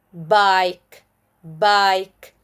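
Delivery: "bike" with a drawn-out "eye" vowel; long I. Shown as a demonstration. Teacher shows magic E words, long I. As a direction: stretch the "i" in "bike". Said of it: "With magic E".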